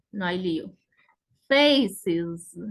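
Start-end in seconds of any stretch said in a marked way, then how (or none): 1.5-2.6 "Faces" with loud first syllable, buzzed iz; IZ ending. Shown as a demonstration. Teacher showing plural of face, clear iz ending. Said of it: "suena I Z".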